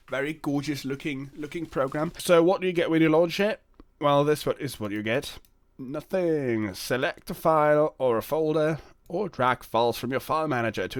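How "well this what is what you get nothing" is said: usual speaking voice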